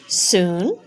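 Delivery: usual speaking voice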